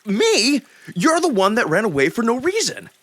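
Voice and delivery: loud, high indignant shout; heated accusing yell; angry retort. Acted. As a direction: throw the blame right back at him. Narrator snaps back at Alex.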